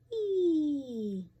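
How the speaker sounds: high, loud sliding whee; a kid's delighted whoop; gleeful yell. Acted. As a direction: shout with joy going down the pole. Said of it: "Callie yells".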